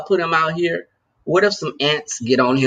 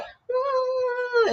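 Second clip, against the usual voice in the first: high pleading hum like a begging puppy; imitated puppy whine; 7 dB quieter than usual. Streamer imitating her puppy guilting her, high whine. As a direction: whimper it the way a puppy begs. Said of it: "They'll be like".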